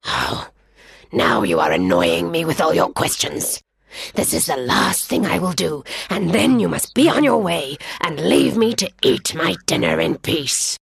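low, irritated lion grumble; annoyed lion. Acted. Lion annoyed by the jackal's questions, low grumble. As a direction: huff at the pest.

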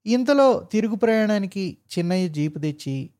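ordinary delivery, nothing marked

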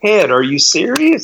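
mock shock at Ted; incredulous. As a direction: ask it in high disbelief. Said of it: "I was like".

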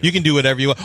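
ordinary talk

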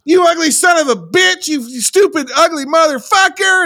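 high-pitched mock scolding screech; abusive Ethel. Podcaster mimicking the mother cursing her son, hammed-up high scolding. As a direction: imitate a mother hurling insults, way up high. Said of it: "she calls her son".

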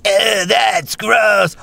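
high, drawn-out cry of disgust; grossed-out character. Acted. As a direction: stretch it out high, utterly grossed out. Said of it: "and all the characters".

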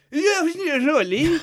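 slurred, mumbled words; garbled speech. Retold or imitated. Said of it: "It just all came out garbled".

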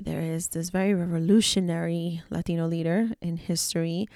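usual speaking voice

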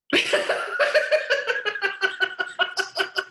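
high, breathy real laugh. Real, not acted. Finishing the story, she laughs it off to the host.

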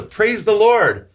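drawn-out fervent praise, high and slow; a believer's joyful exclamation; a joyful, stretched Praise the Lord called out like a happy believer. Retold or imitated. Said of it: "There's a".

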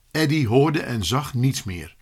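plain reading voice

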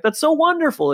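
high, gushing mock delight. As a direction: coo it like a thrilled neighbour. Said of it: "And he's like".

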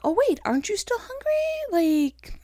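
a playful high voice for her hungry body; body's coaxing voice. Retold or imitated. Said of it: "my body's like".

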